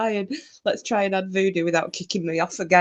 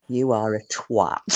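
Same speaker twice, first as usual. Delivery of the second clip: lower, slow mock verdict; imitating Bam; 10 semitones lower than usual. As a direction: drop low and slow, teasing.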